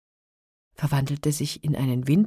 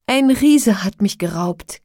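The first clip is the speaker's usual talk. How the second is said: loud, higher lament; klagend; 3 semitones higher, 9 dB louder than usual. A narrator's reading. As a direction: pour out the complaint as the captive wife. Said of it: "klagte sie". (laut klagend)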